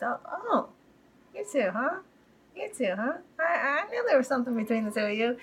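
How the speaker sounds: high-pitched knowing tease, imitated; Irvin. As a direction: lightly play a man teasing a couple. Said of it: "looks over to Darlene and goes".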